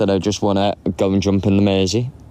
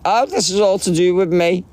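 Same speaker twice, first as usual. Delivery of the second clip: higher-pitched mock self-important voice; self-centred people; 8 semitones higher than usual. Mimicking people who twist the situation, mock self-important. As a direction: lightly mimic someone making it all about them. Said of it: "people managed to twist the situation round".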